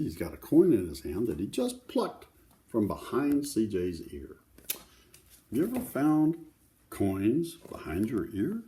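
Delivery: ordinary delivery, nothing marked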